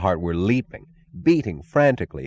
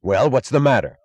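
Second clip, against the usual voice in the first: snapped; 5 semitones higher, 5 dB louder than usual. A narrator's reading. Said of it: "snapped Ferris".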